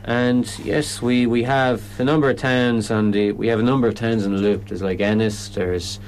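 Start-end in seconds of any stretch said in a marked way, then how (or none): none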